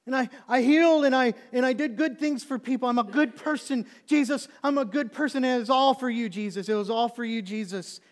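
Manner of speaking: loud, high, frantic self-justifying; desperate cries, easing slightly at the end; impassioned building plea. Acted. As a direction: shout your case as if begging the judge. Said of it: "The passion is building".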